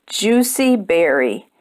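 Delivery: plain reading voice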